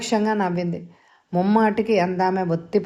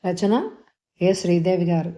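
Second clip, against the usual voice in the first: plain reading voice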